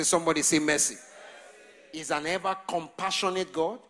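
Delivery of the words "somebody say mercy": louder call. Shown as a demonstration. Pastor calling on the church, louder. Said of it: "Louder, you can say".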